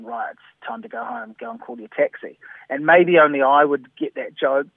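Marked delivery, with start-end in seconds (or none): 0.0-2.4 subtext voiced as a DJ. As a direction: say it like a wink to the crowd. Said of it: "the subtext being".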